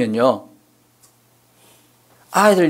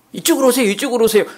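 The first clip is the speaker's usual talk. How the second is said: 이쪽으로 lifted high, a bright welcome; welcoming usher; 7 semitones higher, much faster than usual. Retold or imitated. Pastor acting out greeting parents who bring children, lightly welcoming. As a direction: show the kind way to greet them. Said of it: "아, 이렇게 해야죠".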